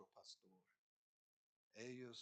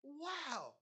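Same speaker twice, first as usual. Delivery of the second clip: amazed; 6 dB louder than usual. Retold or imitated. Amazed as sheep come.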